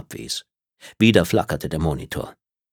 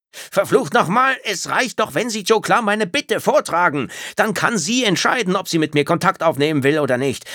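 viel höher und lauter, außer sich; verzweifeltes Schreien; 10 semitones higher than usual. Acted. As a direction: schrei sie an, als ginge es um Leben und Tod. Phil schreit letzte Argumente. (Phil brüllt die Reptiloidin verzweifelt an)

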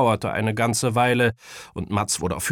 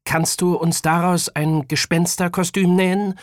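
put-on higher, louder eager child's question; 8 semitones higher, 5 dB louder than usual. A narrator's reading. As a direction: ask keenly, as a hopeful kid. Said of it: "fragte er eifrig". (eifrige Kinderfrage)

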